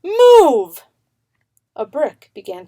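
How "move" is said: high, loud yelled "Move!"; all-out higher yell; top-of-lungs shout. A narrator's reading. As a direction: belt it out as loud as you can. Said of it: "shouted at the top of her lungs".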